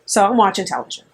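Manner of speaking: ordinary talk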